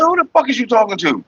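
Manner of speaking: high-pitched indignant challenge; retold angry retort. Confronting the woman about her tone, high and indignant. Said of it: "I said".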